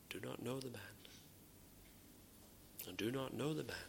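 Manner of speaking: soft, low flat denial; hushed disowning; quiet denial. Retold or imitated. Peter's denial voiced.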